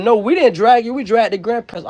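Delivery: mock defensive excuse. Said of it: "A bitch say".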